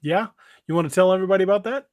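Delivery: normal talking voice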